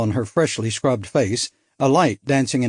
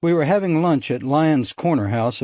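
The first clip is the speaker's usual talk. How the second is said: ordinary delivery, nothing marked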